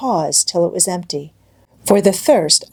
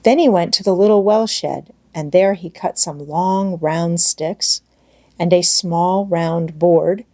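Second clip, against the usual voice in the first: ordinary delivery, nothing marked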